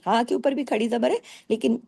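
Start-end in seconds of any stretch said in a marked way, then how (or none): none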